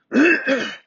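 loud cough to clear throat. Real, not acted. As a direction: hack once to clear the throat. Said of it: "I'm gonna have to do it again".

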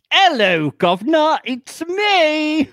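high, loud put-on British accent; hammed-up British hello, shouting; mock British accent. Joking about a Brit showing up in Hollywood, high put-on British accent.

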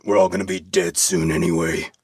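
low gravelly smoker's voice, lightly done; a hint of a cynical old smoker. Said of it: "Gravelly voice, cigarette".

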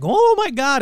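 loud, high overblown astonishment; mock amazement. Imitating everybody falling for the hoax, loud mock amazement. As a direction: exclaim high and loud, mocking the gullible.

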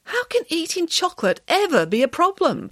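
high, incredulous question; puzzled. A narrator's reading. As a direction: ask in utter bafflement. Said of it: "puzzled Nanny Piggins".

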